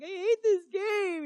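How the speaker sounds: high, loud, slow crying cry of despair. Retold or imitated. Mother retelling a painful vision, crying out loud. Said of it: "I'm crying at this point".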